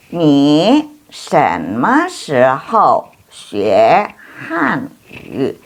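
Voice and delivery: very slow, every syllable drawn out; slow model reading. Shown as a demonstration. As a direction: read it for students to repeat. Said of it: "teacher models Chinese sentence".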